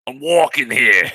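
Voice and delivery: high, loud mock New Jersey shout; New Jersey loudmouth. Joking as someone from Space New Jersey, mock shout. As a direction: yell it like a street-crossing Jersey loudmouth. Joke New Yorker yelling.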